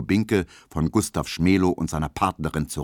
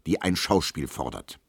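ordinary delivery

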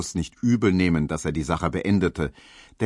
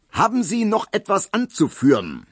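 much higher, loud, booming question; blaring judge; 12 semitones higher, 4 dB louder than usual. A narrator's reading. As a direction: blast it across the courtroom. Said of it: "schmetterte der Richter".

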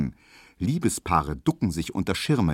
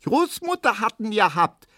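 put-on very high little girl's peep; piping girl; 14 semitones higher than usual. Erna pipes up blaming grandmother over the umbrella. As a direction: pipe it up like a small child. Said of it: "piepst Erna".

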